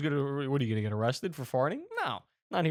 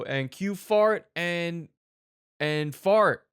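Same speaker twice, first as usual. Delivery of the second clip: slow, raised director's call; calling the cue; 4 semitones higher, 6 dB louder, much slower than usual. Acted. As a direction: call out a film cue, drawn out and louder. Film crew calls the cue.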